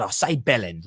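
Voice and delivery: low, soft Cockney bellend; a hint of Cockney teaching a word; Cockney demo. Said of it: "like that".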